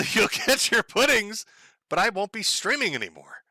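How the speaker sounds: laughing tease. Real, not acted. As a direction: chuckle the promise out, teasing the chat. Laughs while teasing viewers.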